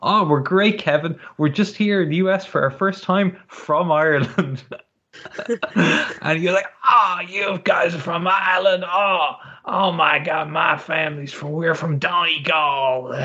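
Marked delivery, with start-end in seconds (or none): 0.0-4.6 higher, over-keen tourist; ingratiating, pitched-up cheer; retold eager reply. Playing eager Irish tourist to Kevin. 6.8-13.1 hammed-up Boston Irish accent; Kevin Sullivan. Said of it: "thick, gruff Boston Irish accent".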